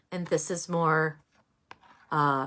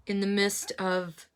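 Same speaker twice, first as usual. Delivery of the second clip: ordinary talk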